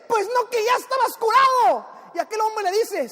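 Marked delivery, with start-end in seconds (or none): 0.1-2.0 high-pitched, shouted objection; acted exasperated cry; indignant protest. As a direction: protest in outraged disbelief, voice shooting up. Waiter protests second coffee in face.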